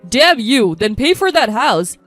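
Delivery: loud, angry outburst; furious. A narrator's reading. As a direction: curse them and demand, loudly. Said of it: "was so angry his face turned red".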